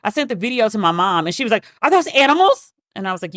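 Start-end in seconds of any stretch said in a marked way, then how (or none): 1.8-2.7 puzzled mom. Retold or imitated. Mom quoted asking about video.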